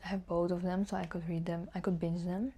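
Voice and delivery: usual speaking voice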